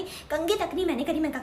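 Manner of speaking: ordinary talk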